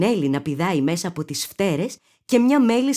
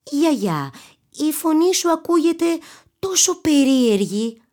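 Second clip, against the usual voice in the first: put-on girl exclaiming; 9 semitones higher than usual. A narrator's reading. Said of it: "αναφώνησε".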